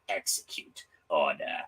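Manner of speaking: soft, slow, breathy sinister rasp; Palpatine voice. Retold or imitated. Palpatine in his chamber giving the order, slow sinister rasp. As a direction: hiss it like the Emperor. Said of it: "sitting there in his little chamber going".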